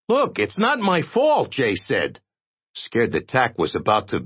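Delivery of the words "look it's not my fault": much higher, defensive protest; defensive young man. Acted. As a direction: hands up, not my fault. Jay defends himself.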